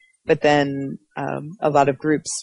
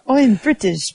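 mock British accent; 7 semitones higher than usual. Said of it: "I was".

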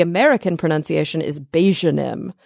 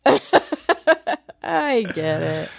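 laughing; 3 dB quieter, much slower than usual. Real, not acted.

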